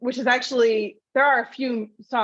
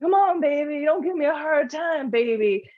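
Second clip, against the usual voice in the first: a bit higher, laid-back beatnik croon; lazy cool-cat hippie voice; lightly voiced beatnik actor; hippie Hitler; 5 semitones higher than usual. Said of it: "he plays it as a hippie".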